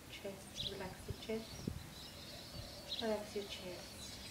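soft, hushed meditation voice with long pauses; guided meditation. Acted. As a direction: lull them gently through a body relaxation.

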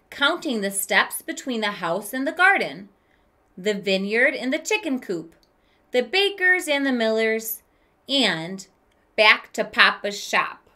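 gentle read-aloud storytelling voice; story narration. A narrator's reading. Teacher reading a picture book to kids, storytelling voice. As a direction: read it like a children's book to the class.

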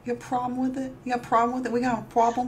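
a hint of New Jersey tough guy. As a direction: mutter the New Jersey challenge. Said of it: "my Jersey accent".

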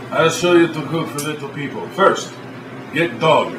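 broken-English Russian host, deadpan; mock Eastern European TV host; fake Russian accent. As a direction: play a stern Russian cook in broken English. Boris cooking show sketch.

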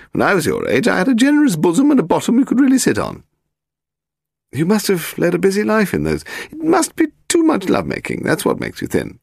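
put-on high, haughty elderly lady; very old woman. A narrator's reading. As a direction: play a grand, frail old aristocrat. Lady Delamore talking.

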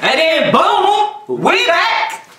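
high, drawn-out boom, laughing; hyped intro call. Acted. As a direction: announce the comeback with big energy. Recording the video intro.

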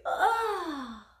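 high, drawn-out stretching yawn; waking yawn. A narrator's reading. Lucie waking in her bed, yawning. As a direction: yawn as you stretch awake. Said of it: "s'étira et frotta des yeux".

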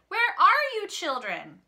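high, loud storybook shout; wolf's shout. A narrator's reading. The wolf shouts for the missing children, loud shout. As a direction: shout out, dropping off on the last word. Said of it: "the wolf shouted".

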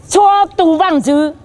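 high, slow, held-out call; preacher calling out a phrase; proclaiming preacher. Real, not acted.

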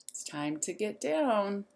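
ordinary delivery

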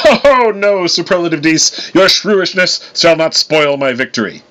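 laugh, then declaim it slowly in triumph; laughing, boastful. A narrator's reading. Thor brushing off a shrewish heckler, laughing and boastful. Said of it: "Laughing, he replied".

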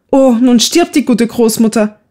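put-on girl fearing grandmother is dying, tearful cry; crying out in tears. A narrator's reading. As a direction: call out as if weeping over grandmother. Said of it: "rief Eva weinend".

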